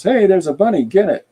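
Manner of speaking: high, loud shout of discovery; excited city dweller. Acted. Space citizen spotting the bunny, loud high shout. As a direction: yell it out like a startled space citizen. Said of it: "looks and goes".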